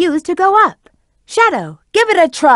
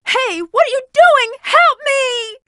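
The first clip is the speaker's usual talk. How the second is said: high, startled plea for help; alarmed cry for help; 9 semitones higher than usual. Acted. Character being grabbed calls to teammates for help, alarmed high cry.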